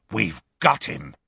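plain reading voice

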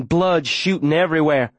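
usual speaking voice

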